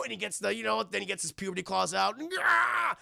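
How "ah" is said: mock roar. Imitated claw roar.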